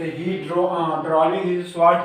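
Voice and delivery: words drawn out one by one for copying; very slow, clear dictation pace. Shown as a demonstration. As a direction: dictate it slowly so students can write. Teacher dictating a sentence.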